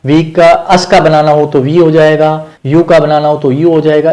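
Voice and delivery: ordinary talk, nothing marked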